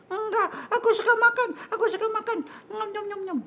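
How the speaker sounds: very high squeaky puppet voice; playful high-pitched puppet; greedy little puppet. Acted. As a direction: chirp about food with a giggle. Puppet answers about fasting.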